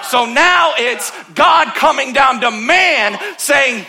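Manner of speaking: slow, loud and high proclamation; preacher's shout. Acted. Pastor to congregation, slow shouted climax. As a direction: shout it out to the whole church.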